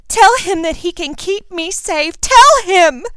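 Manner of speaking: high, loud, rushed pleading; Ginny begging Draco to reassure Harry; hysterical girl. Acted. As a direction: refuse to believe it.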